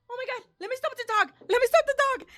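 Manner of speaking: fast, high, building to a shout; frantic panic. Retold or imitated. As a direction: rush it out in rising alarm. Re-enacts panicked thought during attack.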